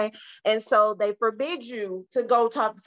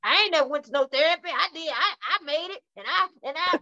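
a touch of a defiant parent, fast; lightly mock a proud parent; stubborn parent; 6 semitones higher than usual. Therapist mimicking a parent refusing therapy. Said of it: "this a big one".

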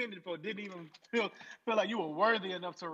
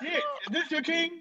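higher, laughing jeer; laughing mock call; 9 semitones higher, 4 dB louder than usual. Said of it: "mock proclamation".

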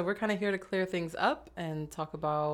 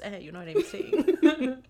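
laughing; 10 semitones higher, 4 dB louder, slower than usual. Real, not acted. Playful aside with laugh.

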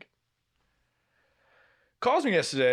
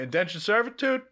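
higher-pitched mock-incredulous question; retold indignation; 7 semitones higher than usual. Son objecting to chores for mother, mock indignant. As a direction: act out an indignant retort, pitched up.

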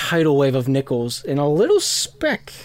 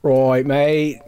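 quick mock Australian; Australian accent. Shown as a demonstration. Game master demonstrating the suggested voice, in an Australian accent. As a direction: put on a broad Australian accent. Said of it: "like, an Australian".

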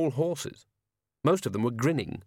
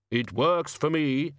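Death voice; 4 semitones higher, slower than usual. A narrator's reading. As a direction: speak like Death, slowly. Death replies.

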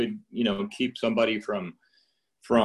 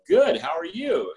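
awkward phone reply; 8 semitones higher, 4 dB louder, slower than usual. Retold or imitated. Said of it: "And they go".

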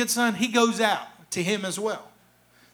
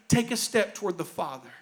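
soft appeal; 5 semitones lower, 6 dB quieter than usual. Real, not acted. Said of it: "You want to bring joy to God today?".

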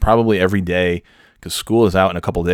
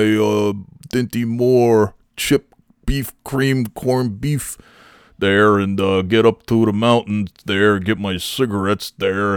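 lumber through a slightly raised, mock-Canadian North Country accent; 3 semitones higher, slower than usual. Mountain local listing his errands, hammed-up North Country accent. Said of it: "a Canadian accent and a person with severe brain damage accent".

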